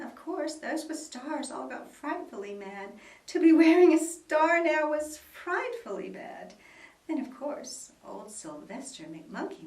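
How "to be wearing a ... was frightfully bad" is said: loud, high dramatic outcry. A narrator's reading. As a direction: wail at how bad it is. Dramatic narration of panic.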